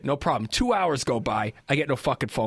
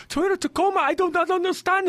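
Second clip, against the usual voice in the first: high, put-on Pakistani accent for the tow truck guy; mock Pakistani accent. Imitating the tow truck driver in a mock Pakistani accent. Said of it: "I was trying to make, uh, Pakistani".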